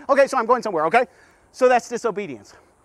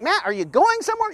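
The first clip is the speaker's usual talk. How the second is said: higher voice of a doubtful listener; impatient listener; 9 semitones higher than usual. Retold or imitated. Listener asking the preacher, mock impatient. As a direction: needle the preacher a little. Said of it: "And you're like".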